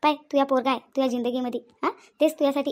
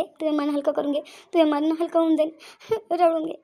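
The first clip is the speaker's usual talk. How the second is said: ordinary talk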